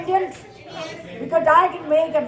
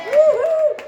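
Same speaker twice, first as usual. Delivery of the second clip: excited whoop; 4 dB louder than usual. Real, not acted. Cheering as she starts.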